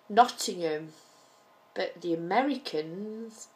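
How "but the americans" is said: ordinary delivery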